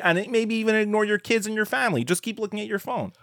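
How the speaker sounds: high-pitched mock voice for an ad's message; mock advertiser. Co-host parodying the phone commercial's message, high mock voice.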